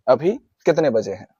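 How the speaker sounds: ordinary delivery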